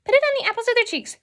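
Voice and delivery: very high, fast, loud imitation; squeaky, rapid-fire tutorial parody; mock tutorial influencer. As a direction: parody a gushing makeup tutorial host. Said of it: "where they're like".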